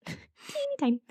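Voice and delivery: squeaky high voice. Pretend. Echoing a cute phrase, laughing.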